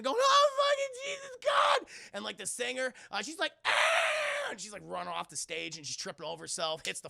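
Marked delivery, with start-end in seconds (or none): 0.2-1.9 very high, loud panicked shriek; hammed-up scream of horror; mock screaming. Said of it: "everyone in the club is just fucking screaming". 3.6-4.5 very high, loud mock shriek; long piercing pretend scream; imitated scream. Acts out the singer screaming.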